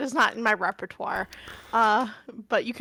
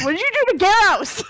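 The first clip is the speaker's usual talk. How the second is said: faster, louder demand; 7 semitones higher, 4 dB louder, faster than usual. Acted. Amari demanding what happened to Garros. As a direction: shake the answer out. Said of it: "shake up and ask".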